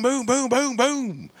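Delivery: very high imitated shots, the last one falling; gunshot booms. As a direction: fire again in a frenzy. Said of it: "and I go".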